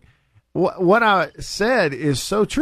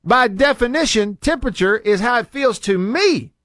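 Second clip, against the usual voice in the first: high-pitched, emphatic protest; exasperated rant; 7 semitones higher than usual. Real, not acted. Radio host ranting at the weatherman about feels-like temperatures, exasperated. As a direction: argue it indignantly, voice riding high.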